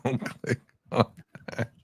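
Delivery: laughing through the warning; giggling as he repeats it. Real, not acted.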